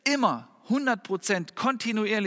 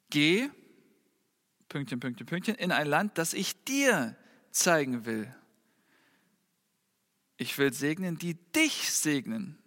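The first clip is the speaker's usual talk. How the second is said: lingering on each phrase; scripture reading; 4 semitones lower than usual. Acted. Preacher reads God's words.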